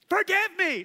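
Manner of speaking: high, loud demand for forgiveness; mock bully demanding pardon; forced apology. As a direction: bark it while twisting their arm.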